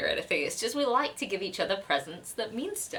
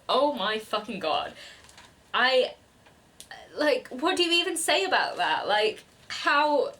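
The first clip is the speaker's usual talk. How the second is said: overwhelmed, emotional; 5 semitones higher, 3 dB louder than usual. Real, not acted. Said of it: "I'm getting emotional".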